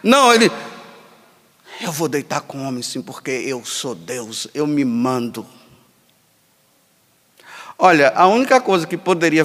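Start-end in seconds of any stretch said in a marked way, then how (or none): 1.8-5.5 low, soft, smug defiance; mock defiant man. Priest voicing a proud man defying God, low smug mutter.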